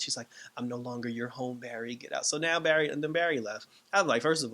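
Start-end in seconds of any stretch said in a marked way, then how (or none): none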